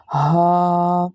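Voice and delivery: हा from the throat, vowel held; sound demo. Shown as a demonstration. Teacher shows students the sound of this letter. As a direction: breathe हा out long. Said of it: "ये वाली हा का sound क्या होगा?".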